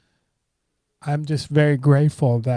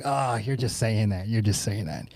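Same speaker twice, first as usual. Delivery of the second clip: modest brush-off; 3 semitones lower, 6 dB quieter than usual. Retold or imitated. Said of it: "I was like".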